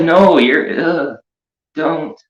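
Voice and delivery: high, shrinking pleas fading away; mock scared. Pretending to cringe away from the creepy clown behind him. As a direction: back off from the creep, half-joking. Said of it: "And it's just like".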